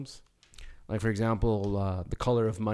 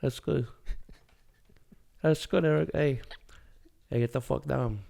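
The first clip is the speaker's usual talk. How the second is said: higher-pitched movie tough guy impression; terse tough-guy phrases with long pauses; De Niro; 4 semitones higher than usual. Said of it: "You got the De Niro".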